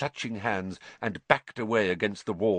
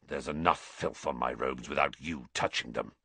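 quiet disgust; 8 semitones lower, 6 dB quieter than usual. A narrator's reading.

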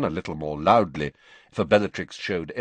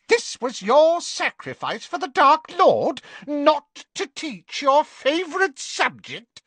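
high, loud mocking witch; jeering; 9 dB louder than usual. A narrator's reading. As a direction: sneer it with contempt. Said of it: "She jeered".

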